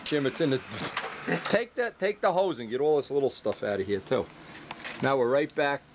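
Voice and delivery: ordinary talk, nothing marked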